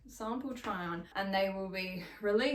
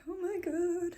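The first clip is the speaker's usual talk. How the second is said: excited; 10 semitones higher than usual. Real, not acted. Delighted by the logo.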